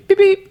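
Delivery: very high, loud falsetto beeps; imitated phone beep. Phone gets a text mid-drive, squeaked imitation beep. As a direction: mimic a text alert in falsetto.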